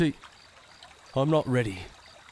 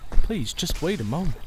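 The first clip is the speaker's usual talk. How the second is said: higher and louder, desperate plea; pleading call; 6 semitones higher, 7 dB louder than usual. Acted. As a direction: call after her, begging her to stay. Sheriff begging her not to leave.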